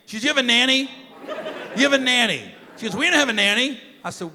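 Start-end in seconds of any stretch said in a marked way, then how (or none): 0.2-2.5 higher, louder indignant disbelief; mad ex-wife. Retold or imitated. Ex-wife finding out about the nanny, imitated indignant outrage. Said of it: "my ex was mad". 3.0-3.8 mad ex-wife. Retold or imitated. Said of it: "my ex was mad".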